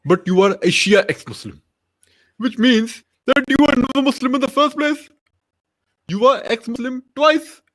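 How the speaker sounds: high, declamatory mock preacher; triumphant preacher logic, climbing in pitch; Zakir Naik. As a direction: build to a high-pitched verdict, phrase by phrase. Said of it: "what would Zakir Naik say to Armin".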